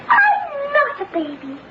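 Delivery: put-on slow, drawn-out childish protest; indignant protest. Child setting someone straight about being little, indignant. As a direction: insist on it, stretching the words.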